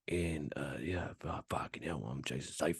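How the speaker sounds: a hint of a hard man growl; mild action hero grumble; Jason Statham voice. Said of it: "like Jason, Jason Statham".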